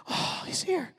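whispered warning. Retold or imitated. Dad whispering to his son at the reunion. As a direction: hiss it under your breath so he won't hear. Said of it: "He goes".

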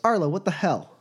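high-pitched, falling demand; mock exasperation. Boss walks up and confronts Arlo, exasperated.

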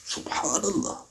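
very soft, breathy-free murmur of wonder; hushed awe. Retold or imitated. Ibn Umar marvels after the visitors leave, hushed. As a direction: marvel quietly to yourself. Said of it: "He goes".

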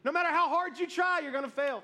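mock naysayer. A naysayer reminding you that you cannot succeed, mock-insistent. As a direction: play the insistent doubter. Voicing someone who always discourages you.

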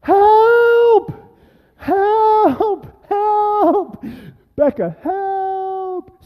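long, high, loud distress calls; yelling for help. Retold or imitated. Lost hiker calls out to Becca for help, panicked yell. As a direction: holler drawn-out cries for rescue. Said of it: "yelling out".

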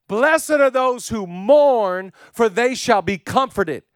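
loud, high, drawn-out verse; impassioned proclamation. Real, not acted. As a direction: proclaim the blessing at full voice. Verse at sermon's climax.

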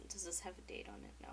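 whispered question to self. Real, not acted. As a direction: mutter it under your breath. Muttered to self.

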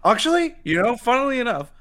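high, loud excited burst; excited squeal. Real, not acted. Cohost sharing farm news, high excited squeal. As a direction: squeak it out with glee.

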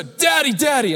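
put-on a tired child begging to be picked up; demanding toddler. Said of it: "And so".

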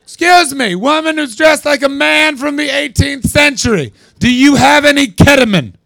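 high loud shouted call across the street; high mock shout; yelled question. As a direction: yell it out to a stranger. Shouting at a costumed stranger.

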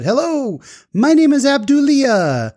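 high, drawn-out exuberant greeting; Abdulia. Retold or imitated. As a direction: greet a stranger in a slow, very high, delighted voice. Voicing the exuberant Uber driver's greeting.

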